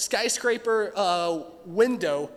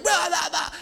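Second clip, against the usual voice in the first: high, louder laughing blah blah; mock yelling; 11 semitones higher, 5 dB louder than usual. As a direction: parody of a ranting driver. Driver yelling at racer.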